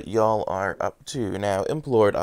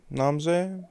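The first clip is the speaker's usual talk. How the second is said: high playful take; 8 semitones higher than usual. Shown as a demonstration. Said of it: "repeated takes".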